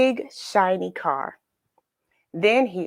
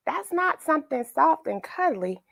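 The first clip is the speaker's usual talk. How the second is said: plain reading voice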